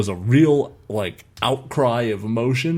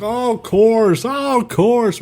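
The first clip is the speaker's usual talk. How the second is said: much higher, slow, sneering "of course"; mocking sarcasm; 11 semitones higher, much slower than usual. Mimicking rival fans at the game, high and slow mockery. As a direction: drag it out to mock the fans.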